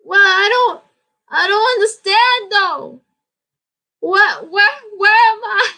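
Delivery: high, bewildered lost voice; high-pitched baffled tourist; confused lost traveler. Retold or imitated. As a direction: play someone lost outside Tokyo, baffled. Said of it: "you're like".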